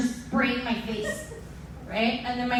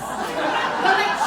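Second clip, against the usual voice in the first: ordinary talk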